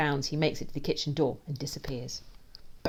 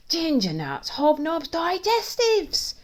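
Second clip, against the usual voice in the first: loud list climbing ever higher; gleeful cry; 14 semitones higher, 8 dB louder, much slower than usual. A narrator's reading. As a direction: crow each treat with mounting glee. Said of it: "cries gleefully".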